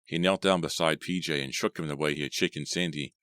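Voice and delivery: ordinary delivery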